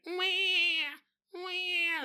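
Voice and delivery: high, thin imitated baby cry; mock newborn wail. Imitating a baby just born, mock newborn wail.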